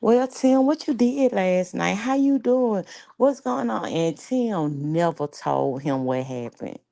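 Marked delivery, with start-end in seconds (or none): 0.0-3.9 high mock innocent tone; friend fishing for info. Friend calling Tim the next day, mock innocent. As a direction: play innocent, pitched up. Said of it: "he was like".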